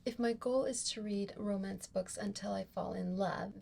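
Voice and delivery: ordinary delivery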